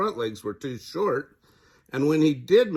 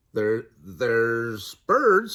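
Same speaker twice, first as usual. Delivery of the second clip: Freddie; 5 dB louder, much slower than usual. Acted. Young Freddie voiced in story.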